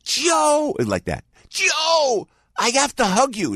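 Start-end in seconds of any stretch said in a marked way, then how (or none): 0.0-0.7 high, drawn-out holler of a name; excited calling out. Retold or imitated. Fan calling to Joe at the elevator, high excited holler. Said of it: "the guy goes like". 1.5-3.6 high, drawn-out excited call; gushing fan. Retold or imitated. Elevator fan wanting a hug, high-pitched gushing. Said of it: "the guy goes like".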